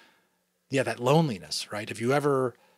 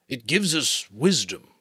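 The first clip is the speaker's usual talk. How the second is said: the giver's voice; 3 semitones higher, 6 dB louder, slower than usual. Acted. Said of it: "the giver replied".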